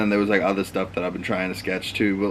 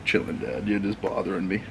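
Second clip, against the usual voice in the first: dog's bored voice; 3 semitones lower, 8 dB quieter than usual. Pretend. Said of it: "He's like".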